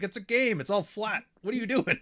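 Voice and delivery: high-pitched exasperated protest; imitated exasperation. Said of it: "He's like".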